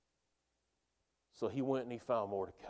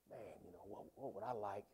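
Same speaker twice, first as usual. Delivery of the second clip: half-voiced musing to himself, much softer; private musing; 6 semitones lower, 15 dB quieter, faster than usual. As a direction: think it over under your breath.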